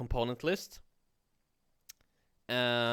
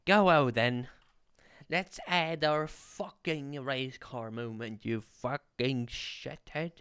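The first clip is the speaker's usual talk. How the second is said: higher, drawn-out mock British accent; British impression; 3 semitones higher, slower than usual. Streamer showing off a British impression to chat, drawn out. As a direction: put on a slow British accent. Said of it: "British impression there".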